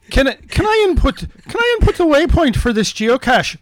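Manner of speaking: put-on high-pitched boy voice; Brendan. Boy asking to input the waypoint, high kid voice. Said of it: "I'll be Brendan".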